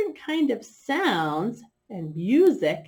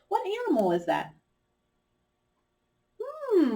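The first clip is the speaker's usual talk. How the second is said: ordinary talk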